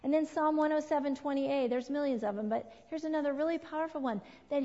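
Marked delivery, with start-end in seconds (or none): none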